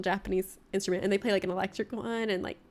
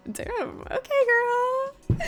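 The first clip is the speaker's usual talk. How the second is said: put-on high drawn-out okay girl, much higher; mock impressed; 13 semitones higher, 3 dB louder, much slower than usual. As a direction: stretch out okay, impressed and flustered.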